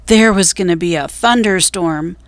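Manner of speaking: ordinary delivery, nothing marked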